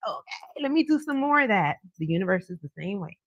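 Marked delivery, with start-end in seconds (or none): 0.1-1.8 pleased provider man. Pretend. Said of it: "They love that feeling like".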